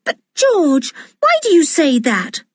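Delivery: put-on high, impatient girl's protest. A narrator's reading. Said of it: "said Anne impatiently".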